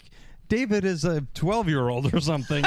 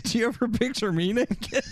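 lightly voiced, higher coy question with a laugh; mock flirty Andrea; 7 semitones higher than usual. Andrea asking the guys if they picture her naked, mock coy. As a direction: tease it out, half-laughing. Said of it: "and she's like".

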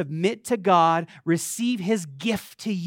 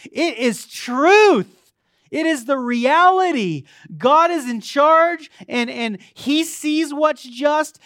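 raised, high preacher's voice with punched words; emphatic preaching; 7 semitones higher, 7 dB louder than usual. Real, not acted. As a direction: drive the assurance home to the whole church. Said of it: "if you think I was too emphatic".

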